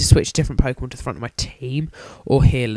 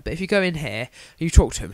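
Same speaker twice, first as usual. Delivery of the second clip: ordinary talk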